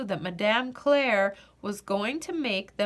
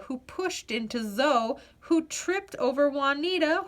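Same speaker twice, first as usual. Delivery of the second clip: usual speaking voice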